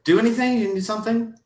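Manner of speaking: high, hurried flustered fussing; fussy mock concern. Mocking the hostess's fuss over a coughing guest, high and flustered. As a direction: flap around offering help in a squeaky voice.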